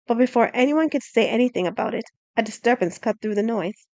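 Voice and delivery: usual speaking voice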